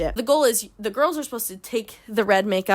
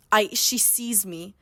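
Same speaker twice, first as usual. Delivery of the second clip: usual speaking voice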